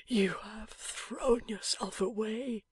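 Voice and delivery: slow, breathless whisper of a wounded man; dying man's whisper. A narrator's reading. Wounded husband whispering to his wife, faint and slow. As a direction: barely get the words out, fading.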